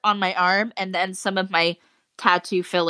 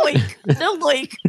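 mimicked botched Australian accent; 7 semitones higher, slower than usual.

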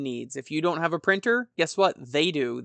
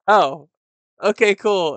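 loud, higher mock-startled okay; mock surprised; 4 semitones higher, 9 dB louder than usual. Host replaying his reaction to Mitch's nope, mock surprise. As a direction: act caught off guard, then shrug it off. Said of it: "And I'm like".

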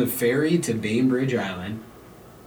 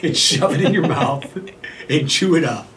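laughing; 4 semitones higher, 3 dB louder, slower than usual. Real, not acted. Joking advice while laughing.

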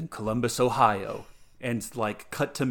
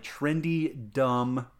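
ordinary talk

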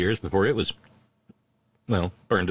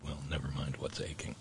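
pained mutter; 6 semitones lower, 11 dB quieter than usual. Real, not acted.

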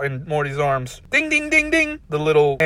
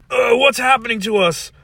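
choking panic; 11 semitones higher, 6 dB louder than usual. Acted. Choking Ricks.

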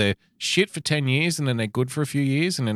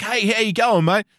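high, bright-sounding cheery Aussie greeting, lightly done; imitating Ricciardo; 9 semitones higher, 4 dB louder than usual. Mimicking Ricciardo's cheery hello to everyone. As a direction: toss off a friendly Aussie hello. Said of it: "always big smile".

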